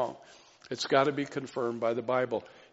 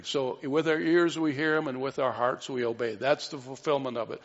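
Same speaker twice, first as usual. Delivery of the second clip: ordinary talk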